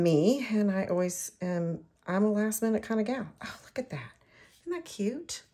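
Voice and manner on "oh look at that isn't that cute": hushed, admiring whisper. Real, not acted. Admiring the finished flower to viewers, whispering. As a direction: breathe it out in delight.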